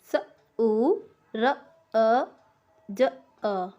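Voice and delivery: स, उ, र, अ, ज, अ said one sound at a time with pauses; each sound of सूरज said on its own; sounds split apart. Shown as a demonstration. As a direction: break सूरज into separate sounds. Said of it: "सूरज".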